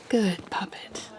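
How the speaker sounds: slow unvoiced whisper, cold and pleased; menacing whisper. Villain whispering orders to the hero as they walk in. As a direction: breathe it slowly like praising a pet.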